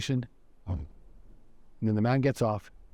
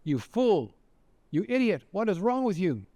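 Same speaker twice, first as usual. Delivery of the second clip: retold scolding scream; 5 semitones higher than usual. Man on the train pretends to scream at the woman, mock outrage. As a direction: berate her, pitched up without full volume. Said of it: "he starts to scream at her".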